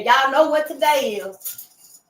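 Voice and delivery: teasing. Shown as a demonstration.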